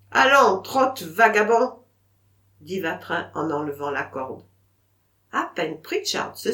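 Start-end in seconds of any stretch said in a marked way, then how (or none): 0.1-1.8 loud dismissive command; gruff send-off. Acted. Vatrin to the dog as he removes the rope, loud and dismissive. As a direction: shoo the dog away loudly.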